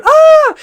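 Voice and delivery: very high, loud startled scream; bystander scream. Retold or imitated. Onlookers watching the sword fight, screaming high. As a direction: shriek like a scared onlooker. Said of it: "People are staring and going".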